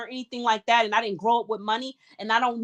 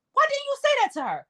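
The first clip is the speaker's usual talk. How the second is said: much higher, disbelieving "why"; high exasperated repeat; 13 semitones higher than usual. Real, not acted. Repeating the question to the mother, exasperated high pitch.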